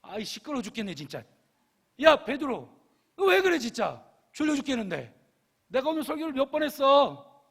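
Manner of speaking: high, loud hammed-up grumpy scolding; irritated outburst. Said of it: "막 성질을 부리면서 화를 내면서".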